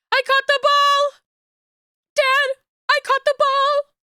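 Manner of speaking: put-on high, slow, squealing little girl; excited child voice. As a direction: shriek it like a thrilled kid. Child voice celebrating.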